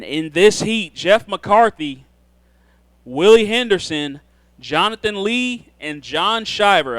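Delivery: slow, drawn-out PA call of names; announcer voice. Acted. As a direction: call each driver's name over the PA. Calls the drivers in the heat.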